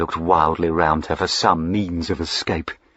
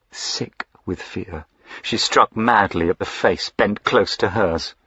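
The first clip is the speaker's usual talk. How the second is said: plain reading voice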